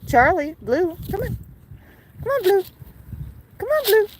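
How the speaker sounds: high coaxing calls to the birds; high pet call. Real, not acted. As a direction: call each bird over in a high voice. Calling birds by name.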